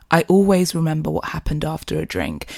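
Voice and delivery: normal talking voice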